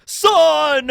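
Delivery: loud, high "Son!" like a cheesy reunion; hammed-up shout. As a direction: bellow it to the son across the room. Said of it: "Dragon's like".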